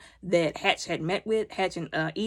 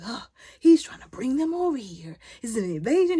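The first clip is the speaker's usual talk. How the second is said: higher-pitched indignant imitation; retold exasperation; 4 semitones higher than usual. He realizes the ship means invasion, retold exasperation. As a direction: act out his alarmed, scornful realization.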